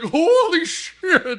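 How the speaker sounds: drawn-out astonished horse; horse shocked. Retold or imitated. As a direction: horse hitting the wall in mock shock. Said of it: "The horse is like".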